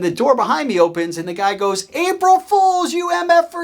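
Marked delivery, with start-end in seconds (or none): 1.9-3.6 high, loud gleeful-prankster yell; prankster's call. Retold or imitated. Boss springs April Fools prank on new hire, imitated high shout.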